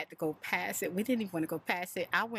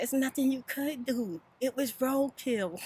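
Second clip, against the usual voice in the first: consoling daughter; 8 semitones higher than usual. Retold or imitated. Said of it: "She said".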